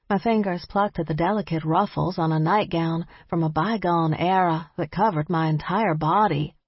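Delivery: usual speaking voice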